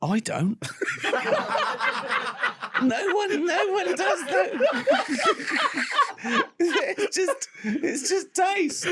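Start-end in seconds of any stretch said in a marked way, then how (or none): 0.6-2.8 high, helpless giggle; high giggling laugh. Real, not acted. Laughing at his own joke about salt. 2.8-8.8 high, squeaky words through giggles; laughing while talking. Real, not acted. Laughing at his own point about taste.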